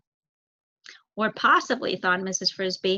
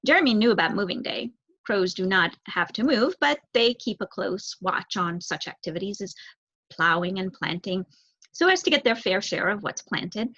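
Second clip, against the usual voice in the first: plain reading voice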